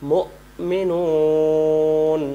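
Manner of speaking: মুমিনুন slowly drawn out to the final n; long recitation. Shown as a demonstration. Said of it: "এইভাবে বলতে হবে". (টেনে মুমিনুন)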